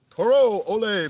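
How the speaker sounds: taunting ole as you sidestep the charge; mock bullfighter. To his charging brother, a loud high mock matador cry.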